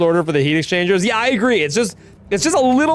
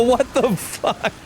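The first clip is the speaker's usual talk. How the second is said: stunned disbelief; 5 semitones higher, much slower than usual. Real, not acted. Shocked by spam message.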